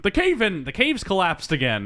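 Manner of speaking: high-pitched mock alarm; Dudley. Hero hears the cave fall in, high mock alarm. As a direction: imitate a hapless hero, voice jumping up. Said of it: "Dudley hears this and he's like".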